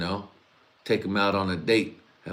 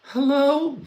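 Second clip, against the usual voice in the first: very high put-on lady voice; fake teacher voice; 6 dB louder than usual. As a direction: squeak it out like a fake woman teacher. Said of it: "I'm gonna pretend to be a teacher".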